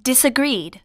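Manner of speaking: usual speaking voice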